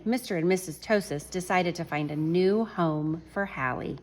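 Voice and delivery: plain reading voice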